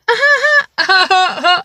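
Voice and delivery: high, loud imitated witch cackle; witch laugh. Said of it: "like, a witch laugh".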